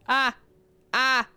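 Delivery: short, loud "ah" yelps; hammed-up yelps of alarm; mock panicked yelps. Streamer squirming at a confession, mock panicked yelps.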